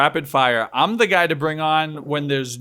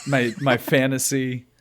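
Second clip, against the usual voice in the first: ordinary talk, nothing marked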